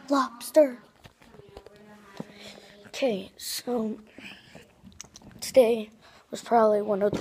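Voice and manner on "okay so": whisper. Real, not acted. Whispering aside to viewers.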